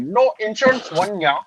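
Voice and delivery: high-pitched put-on Indian accent; mock Indian accent. Same prank-call persona.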